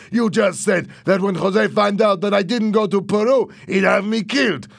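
high, loud outraged protest; heated yelled rant; indignant shouting. A narrator's reading. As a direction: argue back at full voice. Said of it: "shouted Louis".

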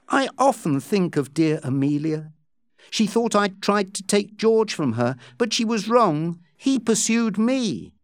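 lightly voiced Becky pleading innocence. Becky defending herself to Joss, lightly pleading.

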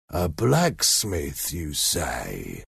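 slow, drawn-out question sinking low and soft; musing convict. A narrator's reading. Man in leg irons considers the blacksmith, slow and musing. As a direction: ponder it slowly, trailing down. Convict ponders the boy's answer.

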